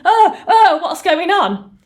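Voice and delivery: high, loud gasped oh-oh of alarm; acted-out jolt of shock; shocked realisation. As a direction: cry out in startled disbelief, high and loud. Acting the entity's panicked shock.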